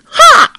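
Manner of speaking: sharp shrieked "ha" very high and loud; fierce attack shout; battle cry. Acted. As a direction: yell as you swing the sword. Battle cry as Nezha strikes.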